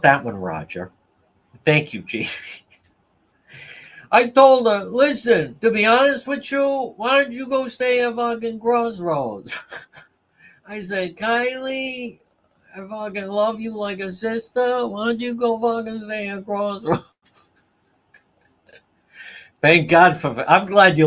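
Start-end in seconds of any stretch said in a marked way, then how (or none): 4.1-9.6 high, loud mocking whine; mocking imitation. Mocking retelling of own advice. 11.2-17.0 mocking imitation. Mocking imitation of advice to Kylie.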